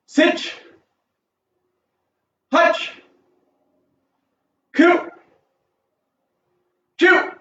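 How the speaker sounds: high, called-out count; counting out reps. Real, not acted. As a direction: call each number up high for the class. Coach calling out count in Japanese.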